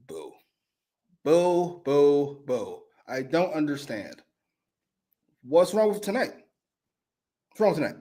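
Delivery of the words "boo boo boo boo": booing. Real, not acted. Booing the segment.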